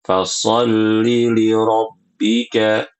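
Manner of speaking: Fasolli li rabbika chanted slowly with the short vowel; short vowel recitation. Shown as a demonstration. Teacher reciting the correct short reading. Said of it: "bacanya pendek".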